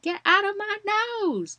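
high, loud mock yell at the nurse; indignant re-enacted outburst, loud and high; retold yell. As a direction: yell it in protest, pitch shooting up. Acted-out fight with the nurse.

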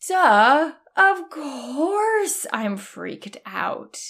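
drawn out like it's obvious; mock-obvious duh. Inner voice realizing the obvious.

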